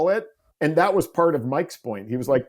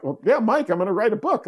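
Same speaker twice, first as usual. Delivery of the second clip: breezy brush-off; 7 semitones higher than usual. Retold or imitated. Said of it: "And I'm like".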